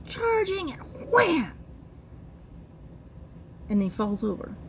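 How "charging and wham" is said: storyteller's high, punchy crash; dramatic impact. Acted. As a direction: build up high, then slam the WHAM. Acting out the bull's impact.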